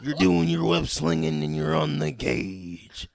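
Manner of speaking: loud slow wrestler promo, laughing. Pretend. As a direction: cut a mock wrestling promo. Wrestler voice impression continued.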